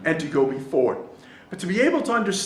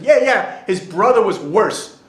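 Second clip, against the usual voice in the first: loud, pitched-up punchline from the crowd; called-out quip; 2 semitones higher, 7 dB louder, slower than usual. Acted. Mourner answers the rabbi's plea, loud called-out quip. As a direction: call it out over the mourners. One guy calls out from the crowd.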